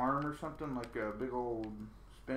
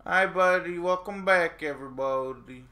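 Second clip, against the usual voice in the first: loud, higher-pitched streamer greeting; 5 semitones higher, 10 dB louder than usual. Real, not acted. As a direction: project a big hello to the stream. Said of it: "That's your I'm on stream voice".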